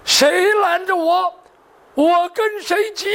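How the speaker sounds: high, slow, stretched-out warning; defiant threat. Acted. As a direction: draw out each word as a heated threat. Threatens whoever blocks his revenge. (拖长声高调威胁)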